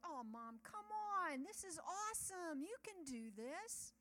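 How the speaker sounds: high, loud, drawn-out encouragement; cheering her on. Retold or imitated. Kids coax scared Mom onto the ride, cheering excitedly. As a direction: imitate an eager family urging Mom on. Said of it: "cheering me on".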